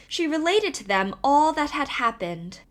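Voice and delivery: ordinary delivery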